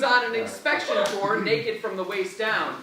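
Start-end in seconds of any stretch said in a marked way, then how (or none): none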